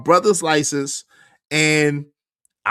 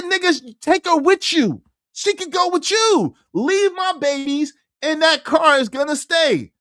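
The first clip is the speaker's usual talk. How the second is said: yelling at the officer to take her; exasperated yell; 10 semitones higher than usual. Retold or imitated. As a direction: throw it at the cop. Yelling at cop.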